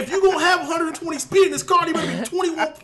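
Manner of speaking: heated, high-pitched rant at full volume; yelling. Real, not acted. Ranting at co-hosts about false advertising, yelling. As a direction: yell it loud and high, worked up. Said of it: "Why am I yelling?".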